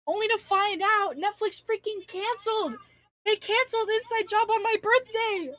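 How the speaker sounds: real furious outcry over a canceled show; outraged shout. Real, not acted. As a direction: yell it like you can't believe they did it. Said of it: "I wanted to cry".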